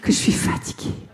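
breathy, exhausted French sigh; weary French sigh. Retold or imitated. Mimicking the French complaining of fatigue, weary sighing. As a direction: sigh it out like a worn-out Parisian, half laughing. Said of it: "It's like their first response, like".

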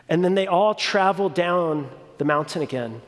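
ordinary delivery

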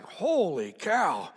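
slow, drawn-out amazement; stunned disbelief. Retold or imitated. Onlookers react as the woman storms off, drawn-out disbelief. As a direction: stretch both words out, imitating astonished onlookers.

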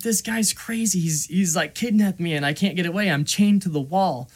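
a touch of imitated desperate pleading. Chained kid to would-be rescuer, mildly pleading.

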